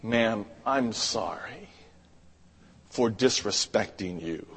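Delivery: hushed contrition; humble apology. Retold or imitated.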